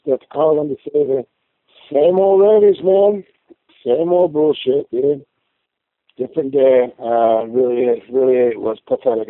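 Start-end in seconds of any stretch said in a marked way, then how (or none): 1.9-5.2 fed-up rant. Real, not acted. Frustrated caller venting.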